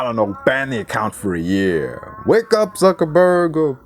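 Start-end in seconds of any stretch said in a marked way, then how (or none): 2.2-3.7 high, loud call to wake up. Real, not acted. Ranting vlogger calling out to the Twitter owner, loud and high. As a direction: shout it at the boss far away.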